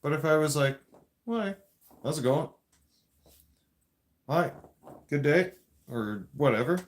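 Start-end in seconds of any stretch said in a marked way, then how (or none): 1.3-5.5 friendly, even, normal-volume hello; polite greeting. Shown as a demonstration. Showing the viewer a respectful greeting, calm and friendly. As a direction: greet them kindly at an easy level. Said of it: "That's different".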